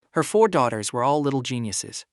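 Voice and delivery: ordinary delivery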